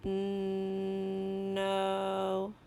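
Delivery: "N-, no" with a long hummed n gliding into a long o; slow sounding-out. Shown as a demonstration. Teacher models sounding out a word, slowly. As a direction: stretch "N-, no" on one level pitch, unbroken. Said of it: "say the word slowly without stopping".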